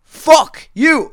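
high, loud put-down; acted-out fed-up yell; retold angry curse. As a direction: fire it back at full voice. Retelling quarrel with the man.

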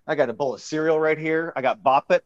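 ordinary talk

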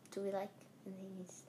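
whispered repeat of the question; whispered echo. Real, not acted. Son echoing dad's question in a whisper. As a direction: mutter it back to yourself. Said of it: "That's what I'm asking".

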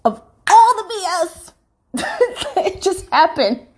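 high, loud cry of disbelief breaking into a laugh; real indignant high-pitched outburst; outraged disbelief. Real, not acted. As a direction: let the outrage spill into a laugh.

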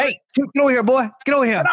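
high-pitched pretend master calling a dog; mock pet-calling. Joker summoning the helper like a pet, mock commanding. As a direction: call a pet over, hammed-up and high.

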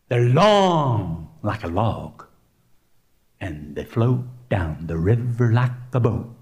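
hushed, low storybook menace with long vowels; ominous crocodile warning. Acted. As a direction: draw it out to spook little kids. Mother monkey warns about crocodiles.

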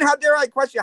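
light mock indignation; incredulous mock echo. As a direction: throw the question back in disbelief. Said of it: "what do you mean".

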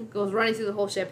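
ordinary talk, nothing marked

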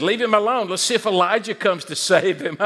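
making fun. A narrator's reading. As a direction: jeer at him, ending in a mocking laugh. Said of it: "They're making fun of him".

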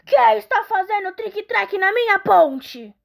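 loud, high bellow from the troll. Acted. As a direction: roar it out as the bridge troll. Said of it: "o troll berrou".

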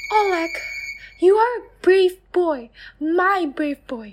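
high, gentle mother's voice; soothing mother. Acted. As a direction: hush a frightened child. Mother praises brave son.

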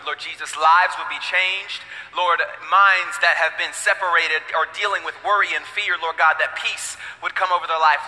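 hushed, low opening prayer. Acted. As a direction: pray it softly over the room.

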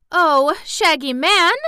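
high, loud, drawn-out dismay; reproachful storybook exclamation; shocked cry. A narrator's reading. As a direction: cry out as if scandalized by a friend. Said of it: "cried Dorothy".

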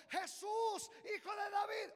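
loud high cry. Acted. Blind man calling to Jesus passing by, loud cry. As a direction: call out to Jesus at full voice. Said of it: "comenzó".

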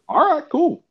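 very high, loud mock voice; Mark Zuckerberg. Mock billionaire accepting a visitor's answer, shrieky-high put-on voice. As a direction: pitch it way up in an exaggerated caricature. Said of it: "He's like".

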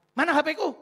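high, loud wife's demand; snatch it back, offended; indignant wife. Retold or imitated. Wife grabs phone angrily.

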